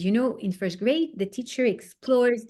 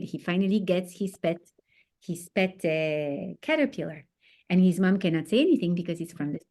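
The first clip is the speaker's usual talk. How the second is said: ordinary delivery, nothing marked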